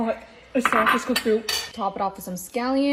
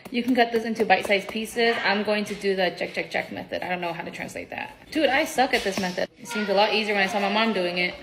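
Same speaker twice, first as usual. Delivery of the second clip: ordinary talk, nothing marked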